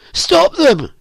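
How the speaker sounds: high, loud, urgent cry. A narrator's reading. Elizabeth begs the narrator to stop the fight, crying out. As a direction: shriek it as a plea for help. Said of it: "cried Elizabeth".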